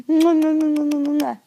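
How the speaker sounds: string of loud smacking kisses; rapid kisses. Shown as a demonstration. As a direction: pepper the doll with kisses.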